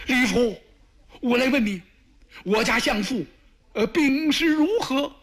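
put-on high, strained voice of a frightened young emperor; panicked ruler begging for news, voice pushed up high; anxious emperor. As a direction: ask in dread, pitch climbing high. Emperor anxiously asks about chancellor.

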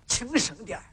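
soft warning to keep quiet; hushed. Acted. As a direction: hush him under your breath. Hushing a secret business partner.

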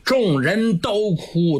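ordinary delivery, nothing marked

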